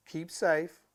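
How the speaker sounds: usual speaking voice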